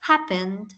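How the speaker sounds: HAP-pened, sliding downward; stress on first syllable. Shown as a demonstration. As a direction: hit HAP in happened and drop away. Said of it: "como si ella iniciara grande, alta".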